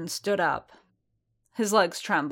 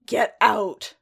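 angry snarl, louder and sharp; 3 semitones higher, 6 dB louder than usual. Acted. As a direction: snarl it at him in anger. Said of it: "Paz snarled".

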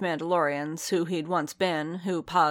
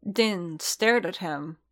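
usual speaking voice

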